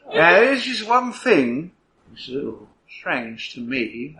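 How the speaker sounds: slow, pondering detective voice; musing inspector. Acted. Inspector thinking aloud about the odd flame strike, musing. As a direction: puzzle it out slowly, drawing words out. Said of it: "Inspector goes".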